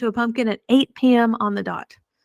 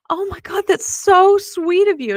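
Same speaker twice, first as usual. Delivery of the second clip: touched gush; 10 semitones higher, 3 dB louder than usual. Retold or imitated. Woman moved by gift.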